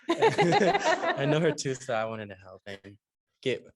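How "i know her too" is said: high, loud, slow laugh. Real, not acted. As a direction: burst out laughing while saying it. Repeats line laughing.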